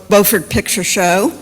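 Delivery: ordinary talk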